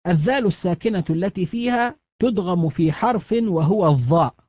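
ordinary talk, nothing marked

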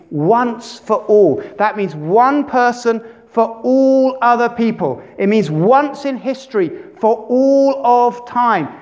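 rising preacher fervour; building emphasis. Real, not acted. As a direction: drive home the word all.

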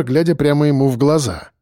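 usual speaking voice

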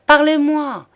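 high, loud desperate begging; frantic plea. Acted. A maid begs the lawyer through the door, high and frantic. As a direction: cry out through the door in panic.

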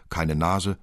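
usual speaking voice

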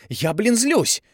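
high and loud, furious; angry yell. A narrator's reading. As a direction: shout your anger. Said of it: "заорала Тави".

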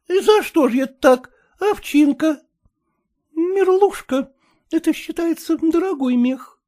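high, bantering women's voices; high-voiced women. A narrator's reading. Aunt and bride bantering over gifts, high. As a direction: chatter high and lively.

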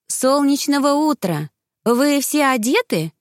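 greet, then ask tentatively in a high voice; cautious inquiry. A narrator's reading. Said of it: "осторожно поинтересовалась Эльва". (осторожно, высоким голосом)

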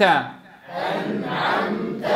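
أنعمت whispered very slowly; whispered drill. Shown as a demonstration. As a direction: breathe أنعمت softly. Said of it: "قولوها معي".

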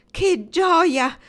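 high, loud burst of joy; joyful exclamation. A narrator's reading. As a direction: exclaim it, overjoyed, pitch leaping up. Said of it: "esclamò Lauren". (esclamazione di gioia, acuta e forte)

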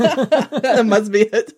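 slow laugh through the words. Real, not acted. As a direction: chuckle it out slowly. Laughing at the Mary joke.

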